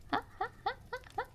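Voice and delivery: high, soft staccato imitation laugh; put-on laugh in evenly spaced bursts; Pekora laugh. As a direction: mimic a cartoonish, high laugh. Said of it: "Pekora recently".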